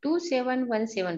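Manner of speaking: normal talking voice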